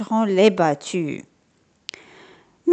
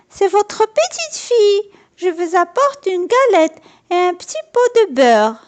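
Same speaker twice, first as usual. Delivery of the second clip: put-on much higher, polite little girl; little girl's voice; 10 semitones higher than usual. A narrator's reading. Girl at the door announcing her gifts, sweet and high. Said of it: "Elle lui répondit".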